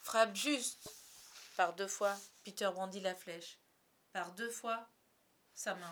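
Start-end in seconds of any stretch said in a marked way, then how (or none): 0.0-0.9 loud, resolute command; firm. A narrator's reading. La guigne tells Peter to strike, firm. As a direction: give the order bravely and squarely. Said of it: "dit-il d'un ton ferme".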